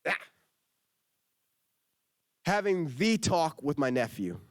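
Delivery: normal talking voice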